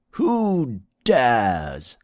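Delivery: a prince's furious hoarse challenge; hoarse, imperious prince; hoarse demand. A narrator's reading. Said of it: "he demanded hoarsely".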